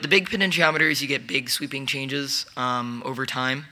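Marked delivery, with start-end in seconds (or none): none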